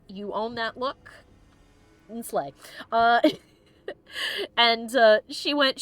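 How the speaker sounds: usual speaking voice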